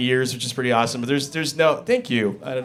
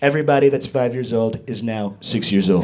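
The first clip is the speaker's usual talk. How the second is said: low, smug Trump voice; low mock Trump; Trump impression; 4 semitones lower than usual. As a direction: declare it like it's law. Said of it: "He's like".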